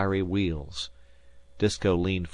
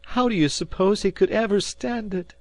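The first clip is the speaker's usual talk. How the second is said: much higher, louder outcry; indignant cry; 13 semitones higher, 4 dB louder than usual. A narrator's reading. Mother to Shane about her fainted boy, indignant cry. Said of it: "she cried indignantly".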